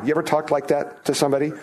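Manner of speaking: ordinary talk, nothing marked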